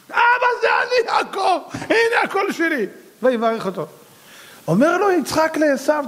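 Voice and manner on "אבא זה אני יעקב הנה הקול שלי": very high, slow mock-innocent call; naive Jacob. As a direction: announce it guilelessly, drawn out and high, near laughter. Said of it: "הוא בא בתמימות". (קול גבוה ותמים בהגזמה)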